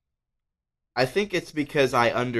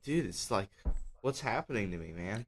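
much softer, half to himself; bewildered murmur; 12 dB quieter than usual. Retold or imitated. Retelling to friends how he wondered why he cried, softly murmured. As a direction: mutter the question as if puzzled at yourself. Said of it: "I'm like".

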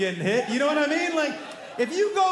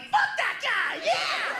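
put-on very high squeaky kid cheer; a pack of six-year-olds cheering, pitched way up; squealing child hype; excited little kids; much slower than usual. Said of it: "I'm like".

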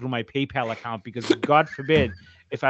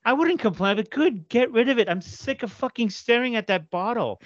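higher voice for dad; imitating dad; 9 semitones higher than usual. Son mimicking his father's reply, lightly voiced.

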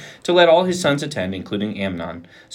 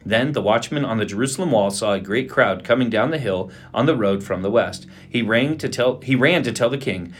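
ordinary delivery, nothing marked

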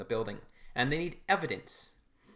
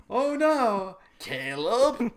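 higher, louder hammed-up wail; mock cry; 9 semitones higher, 7 dB louder, much slower than usual. Running joke wailing for Caleb, hammed-up. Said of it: "it's like".

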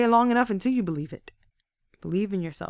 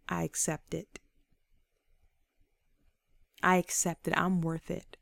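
low, hushed, prayerful declaration; sincere self-affirmation; 4 semitones lower, 6 dB quieter than usual. Real, not acted. Speaker praying to Father and accepting, hushed sincerity. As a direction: affirm it quietly, as in private prayer.

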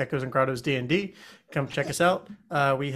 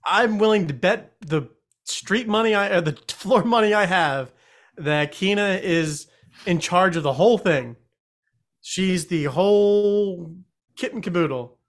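loud, higher, insistent claim; emphatic accusation; 5 semitones higher, 5 dB louder than usual. Real, not acted. Player betting the game master's character is guilty, emphatic accusation. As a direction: make the case loudly, stretching the big words. Hammed-up accusation of Kina after session.

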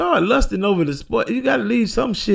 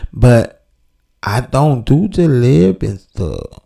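lower, slow put-on woman's voice; confessing woman; 8 semitones lower, 4 dB louder, slower than usual. A woman named Rebecca confessing on the show, put-on voice. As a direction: play a caller confessing her troubles.

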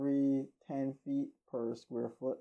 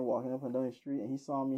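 ordinary delivery

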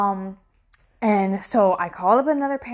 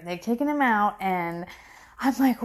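normal talking voice

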